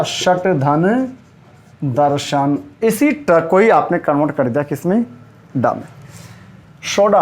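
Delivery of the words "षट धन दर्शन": षट धन spaced out very slowly, each part apart; very slow split. Shown as a demonstration. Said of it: "संधि में क्या आएगा". (षट धन बहुत धीरे अलग करके)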